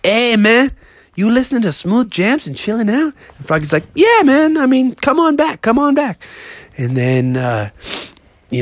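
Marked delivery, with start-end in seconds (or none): 0.1-3.1 higher, easygoing neighbor voice; casual greeting, pitched up; Iggy the Iguana. Acted. Neighbor iguana drops by to chill, friendly. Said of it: "Iggy the Iguana was like". 4.0-6.2 high, upbeat invitation; welcoming frog. Acted. Froggy invites the iguana out back, welcoming.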